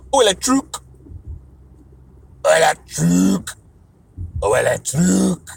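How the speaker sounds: slow, lightly put-on French; playful French. Speaker toying with French words, slow and playful. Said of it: "when I talk another language".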